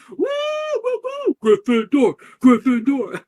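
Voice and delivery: high, loud house cheer; excited hollering. Acted. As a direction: hoot and chant like a thrilled crowd. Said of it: "start to hoot and holler with excitement".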